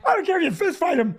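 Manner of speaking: high, urgent wife's plea; nervous wife telling him to drive off; frightened wife. Retold or imitated. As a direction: hurry him along, scared. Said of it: "She's like".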